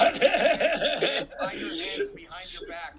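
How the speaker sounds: high, slow, laughing tease. Real, not acted. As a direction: laugh it out at her fumbling. Teasing friend fumbling with camera.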